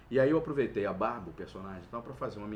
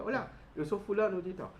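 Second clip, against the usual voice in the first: bad impression; 6 semitones higher than usual. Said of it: "a imitação não era porra nenhuma".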